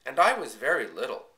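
lightly voiced little girl, proud and eager. A narrator's reading. Child Cassie boasting to grandfather.

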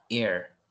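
"Ear" like "ir", the A left silent; pronounced without A. Shown as a demonstration. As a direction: say "Ear" with an I sound gliding into R. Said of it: "Es como que le quite la A".

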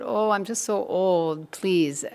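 a hint of a weary old man's plea. Man lying on temple floor to Namdev, old and pleading. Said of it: "And the man said".

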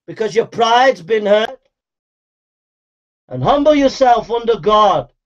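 ordinary talk, nothing marked

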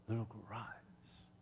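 slow, very soft whisper; hushed whisper. Retold or imitated. Jesus' words to the dead girl, retold in a whisper. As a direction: whisper it gently and unhurriedly.

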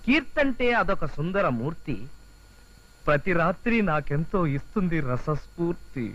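very slow romantic verse reading; drawn-out, self-admiring recital; poem recitation. Acted. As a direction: linger on every rhyme of your love poem. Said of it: "చదువుతాను చూడు".

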